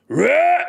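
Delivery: high, short mock "Rah!" roar; demo roar. Shown as a demonstration. Streamer demonstrating the proper roar to gamers, short high mock roar. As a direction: show chat how the roar should sound. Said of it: "you're supposed to end it with a row".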